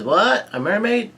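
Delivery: high, questioning surprise; surprised. A narrator's reading. Brook answers Chopper about a mermaid, surprised. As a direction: ask it in rising disbelief. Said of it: "Brook is surprised".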